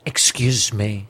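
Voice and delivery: slow theatrical dad voice with pauses; drawn-out stagey politeness; classical stage acting. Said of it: "he's got a very theatrical voice".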